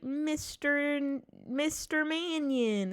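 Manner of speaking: high, slow character voice; Mister Nimbus. Acted. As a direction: stretch the name out high, mock-grand. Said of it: "I say it in the Mister Ni".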